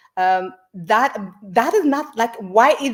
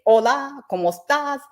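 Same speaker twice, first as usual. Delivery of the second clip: mock greeting; 6 semitones higher than usual. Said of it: "why is it that you don't say".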